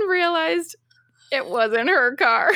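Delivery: high, loud laughing cry on the reveal; laugh-shout the twist of the story; laughing punchline. Real, not acted. Friend tells co-host the viral twist, laughing and loud.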